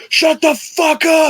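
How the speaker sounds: drawn-out, high-pitched mock shout; grandpa yelling. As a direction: bellow slowly, imitating an angry grandpa. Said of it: "keeps fucking yelling at us".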